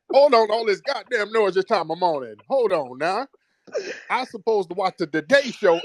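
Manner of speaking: put-on high, grumbling elderly patient; cranky old man voice. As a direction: whine like a bossy old invalid. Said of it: "You're working per serial, like".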